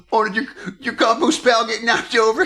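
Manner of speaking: high-pitched mocking mock concern; sarcastic. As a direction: ridicule him with fake sympathy. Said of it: "which sounds sarcastic".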